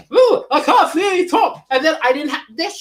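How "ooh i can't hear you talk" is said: high, loud put-on voice dodging the call; hammed-up excuse; mock excuse voice. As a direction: play it up as if you can't hear them. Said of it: "I was able to say".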